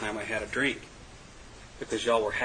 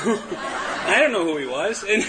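slow words through a laugh; 8 semitones higher, 3 dB louder, slower than usual. Real, not acted. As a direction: laugh while admitting it. Laughs at his own naivety.

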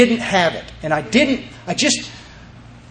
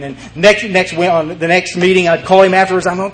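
usual speaking voice

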